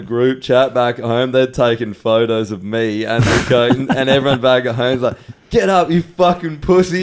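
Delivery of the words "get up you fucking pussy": high-pitched mock heckle from the mates; rowdy mate goading him out of bed; roasting. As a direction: jeer at a friend in a raised pitch. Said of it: "like, roasting me".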